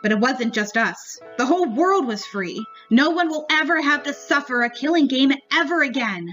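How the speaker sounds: usual speaking voice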